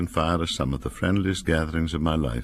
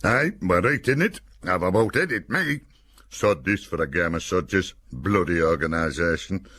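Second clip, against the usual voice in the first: griping Cumbrian soldier; 3 semitones higher than usual. A narrator's reading. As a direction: moan it in broad Cumbrian. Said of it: "Nine Section gave pessimistic growls".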